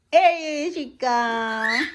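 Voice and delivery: slow teasing build-up; playful suspense. Acted. Parent to baby as the dog approaches, teasing slow. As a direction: stretch it out like a game of chase.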